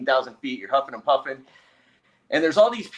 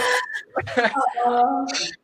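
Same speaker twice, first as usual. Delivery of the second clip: teary laugh; 10 semitones higher than usual. Real, not acted. Laughing at own story.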